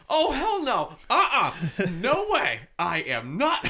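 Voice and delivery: high, loud retold protest; hammed-up high-pitched refusal; mock indignant refusal. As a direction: imitate her digging her heels in. Acting the frightened girl's refusal.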